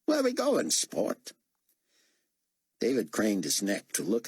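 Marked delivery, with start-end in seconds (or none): 0.0-1.3 excited Australian driver, much higher. A narrator's reading. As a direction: gush about the resurrection like an awed Aussie fan. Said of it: "his accent making David guess he was an Aussie".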